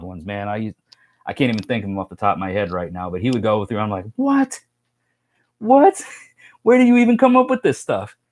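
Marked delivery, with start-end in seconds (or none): 4.1-8.2 high, incredulous "What? What?" with a laugh; baffled disbelief. Retold or imitated. Reacting to an Aussie friend's slang, high baffled disbelief. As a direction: squeak out disbelief, then laugh.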